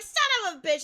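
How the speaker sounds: loud, high mock cry of frustration; retold exasperated outburst. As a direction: re-enact your frustrated outburst, half laughing.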